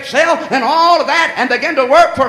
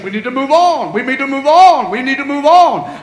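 louder each time, hammering move on; building refrain; 3 semitones higher, 6 dB louder than usual. Acted. Pastor urging the church, building refrain. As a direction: drive the line home.

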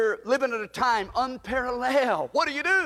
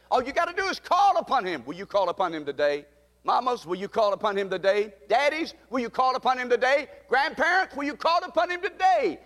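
climbing preacher's call to each family member; rising preaching call; 3 semitones higher, faster than usual. Acted. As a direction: plead with mamas, daddies, grandparents in climbing preaching cadence. Preacher calls out to congregation.

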